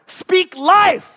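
loud, high rallying shout. Acted. Preacher shouting a call for the crowd to echo, loud. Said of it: "So I go".

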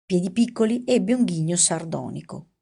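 ordinary delivery, nothing marked

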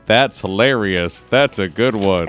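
slow, flat robotic monotone; deadpan robot reading. Retold or imitated. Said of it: "Why do you make me sound like a robot?".

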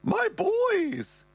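high falsetto mother, drawn out; mother's voice. Retold or imitated. Mother greeting her sons, high drawn-out falsetto. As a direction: gush it in a high put-on voice. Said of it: "she goes".

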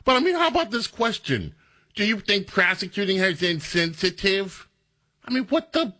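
high, incredulous mock question; sarcastic high-pitched send-up of the reporter. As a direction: parrot the question with scornful disbelief. Mocking the question again.

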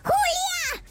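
high, drawn-out call to a sister; grito actuado. Acted. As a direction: call out high, as if across the house. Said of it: "le grita a su hermana". (grito agudo llamando a la hermana)

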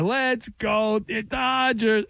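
imitated kid's chant, high and stretched out; cute little kid voice. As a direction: draw out the cheer like a small fan. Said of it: "with his cute little kid voice".

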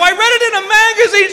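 high, comic protest; defensive husband. Retold or imitated. Husband explaining the idea to angry wife, defensive.

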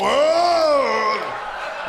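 hammed-up roar of effort; mimicked straining groan. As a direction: groan it out big and long. Mimes the overly strong grip.

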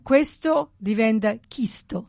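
questo said with a hard k, chisto; chisto, k replacing qu; dialect chisto. Shown as a demonstration. Explaining dialect, questo turned to chisto. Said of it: "It's a k".

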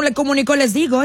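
ordinary delivery

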